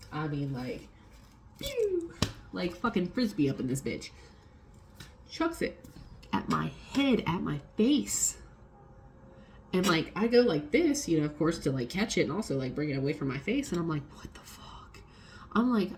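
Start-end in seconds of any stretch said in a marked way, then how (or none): 1.6-2.1 whooshing pew. Retold or imitated. Sound of paper hurled at her. 14.2-15.0 a hushed, disbelieving whisper; shocked whisper. Retold or imitated. Retelling her reaction to her boss, whispered in shock. Stunned reaction when paper thrown.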